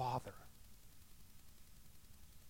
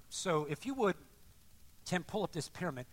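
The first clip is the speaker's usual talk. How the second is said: ordinary delivery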